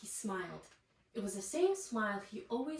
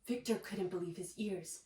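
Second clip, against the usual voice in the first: usual speaking voice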